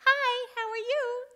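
put-on high, loud sing-out in a small kid's voice; squeaky-high little voice, loud and friendly; little child's voice. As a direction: chirp it like a little kid calling from the next stall. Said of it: "a little voice says".

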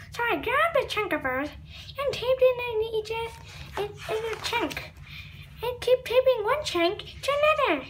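put-on lightly high baby voice. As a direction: babble it like a toddler. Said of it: "my baby impression".

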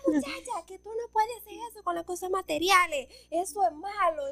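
a hint of a stern mother lecturing; mom scolding. Mom scolds her for crying over a truck, lightly voiced. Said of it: "y me dice".

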